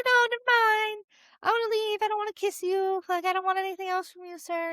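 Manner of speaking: put-on flustered girl edging away; nervous girl. Girl backing off from creep.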